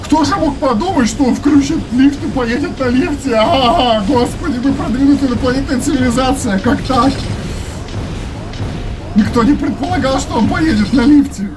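high, sarcastic mock astonishment; sarcastic mockery. Real, not acted. Mocking the aliens' plan.

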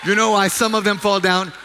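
loud, high sermon delivery, driving each question home; fervent preaching. Acted. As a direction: preach it at full force to the congregation.

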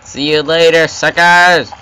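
loud, high gloating yell; taunting shout. Real, not acted. Gamer taunting enemies as he escapes, loud gloat. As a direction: gloat as you run off.

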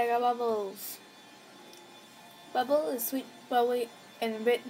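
ordinary delivery

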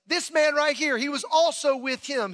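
single him out for everyone to hear; pointed accusation. Acted. Servant girl pointing out Peter by the fire, accusing.

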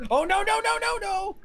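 high alarmed no's; rising dismay; panicked shout. Real, not acted. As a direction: cry out in alarm. In-game mishap.

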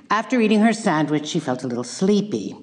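ordinary talk, nothing marked

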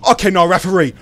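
high, loud protest; outraged shout. Real, not acted. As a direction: yell at the referee in disbelief. Protesting in-game hit.

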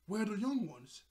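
acted-out urgent demand. Mayor demanding to find the pirates.

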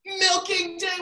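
high, joyful cheer; excited announcement. A narrator's reading.

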